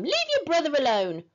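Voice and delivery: understated cross shout; shouted scolding. A narrator's reading. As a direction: scold with a touch of force. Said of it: "shouted Mum".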